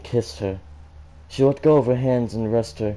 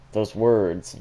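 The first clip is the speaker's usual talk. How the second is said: usual speaking voice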